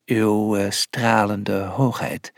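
low, slow formal announcement; low, hesitant ceremonial address; solemn old man. A narrator's reading. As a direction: announce haltingly as an aged dignitary. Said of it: "kondigde de oude man aan".

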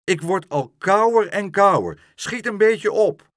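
high, loud, exasperated complaint; angry impatience. A narrator's reading. Horse getting cold in water, angrily impatient. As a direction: complain loudly, urging them to hurry. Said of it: "brieste Langhors".

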